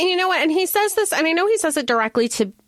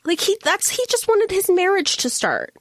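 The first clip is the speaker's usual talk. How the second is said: ordinary talk, nothing marked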